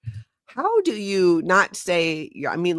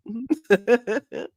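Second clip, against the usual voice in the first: short laugh; 7 semitones higher than usual. Real, not acted. Laughing at her own slip.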